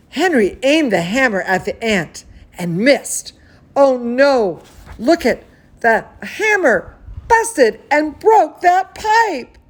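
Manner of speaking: put-on bright, lively read-aloud for kids; storybook narration to children; story reading. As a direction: read it like bedtime story time. Reading a picture book aloud.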